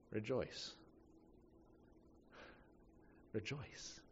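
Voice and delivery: a grieving mother forcing out Rejoice; quietly imitating a mother broken by grief. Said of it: "she's broken down, she's crying".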